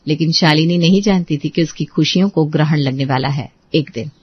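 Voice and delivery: hint at coming trouble; story narration. A narrator's reading. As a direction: narrator foreshadowing the eclipse of joy.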